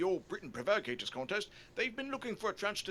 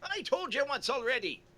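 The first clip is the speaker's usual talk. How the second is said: put-on Irish accent; 6 semitones higher, 5 dB louder than usual. O'Leary character answering.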